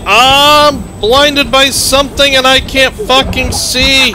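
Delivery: slow and exasperated. Real, not acted. Blinded by smoke in game.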